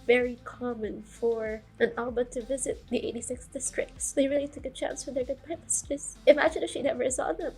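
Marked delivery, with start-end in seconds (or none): none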